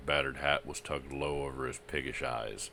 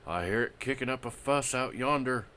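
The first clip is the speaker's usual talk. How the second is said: hoarse voice; 10 semitones higher, 5 dB louder than usual. Acted.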